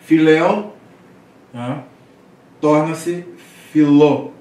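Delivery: Filéu and filó called out loud and high; contraction demo. Shown as a demonstration. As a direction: proclaim each Greek form for the class. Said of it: "circunflexo sobre a vogal contraída". (professor pronuncia filó bem alto)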